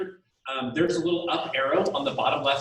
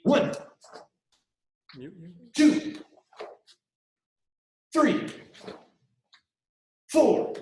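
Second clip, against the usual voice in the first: loud barked count; 4 semitones higher, 4 dB louder, much slower than usual. Real, not acted. Instructor barking kick count.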